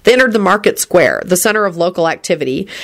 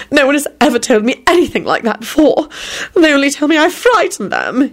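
tearful shudder; 8 semitones higher than usual. A narrator's reading. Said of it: "he shuddered".

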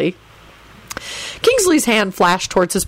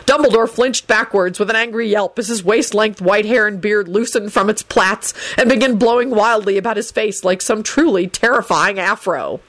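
plain reading voice

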